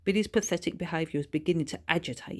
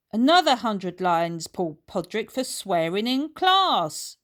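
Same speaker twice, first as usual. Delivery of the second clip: stern reprimand; 4 semitones higher, 6 dB louder than usual. A narrator's reading. Teacher punishes Paul.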